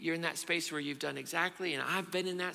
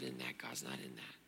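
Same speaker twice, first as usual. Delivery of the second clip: fading murmur; 13 semitones lower, 12 dB quieter than usual. Acted. Repetition fading.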